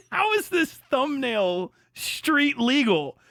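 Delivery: high, slow disbelief; slow stunned question; incredulous. Real, not acted. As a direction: ask it as if you can't believe it. Reacting to lewd thumbnail.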